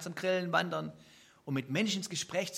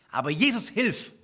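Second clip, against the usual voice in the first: urgent call, louder and higher; cry for help; 5 semitones higher, 8 dB louder than usual. Acted. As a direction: cry out to Jesus in need. Example cry in distress. (dringender Hilferuf an Jesus)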